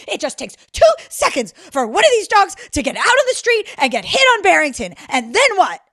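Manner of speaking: loud, high rant of an angry stranger; imitated screaming. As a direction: yell the scolding as the man did. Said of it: "fifty yards away screaming".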